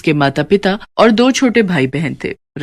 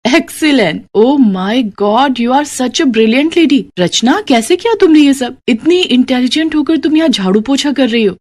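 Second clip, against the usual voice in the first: higher, slow, amazed exclaiming; amazed praise; 6 semitones higher than usual. Acted. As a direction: gush over her brilliance in disbelief. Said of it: "हैरान रह जाती है".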